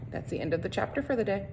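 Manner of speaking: usual speaking voice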